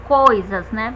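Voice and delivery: ordinary talk, nothing marked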